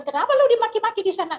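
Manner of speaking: high, quick astonished question; surprised friends. Retold or imitated. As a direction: ask in disbelief why he was scolded. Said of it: "Akhirnya tadi bilang".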